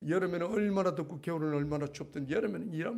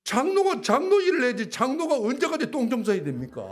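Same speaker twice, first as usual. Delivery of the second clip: loud high aggrieved complaint to God; complaining to God; 7 semitones higher, 6 dB louder than usual. Retold or imitated. Elder grumbling to God about cleaning toilets, loud and aggrieved. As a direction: cry out your grievance, louder and higher. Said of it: "주님 앞에 불평을 했어요".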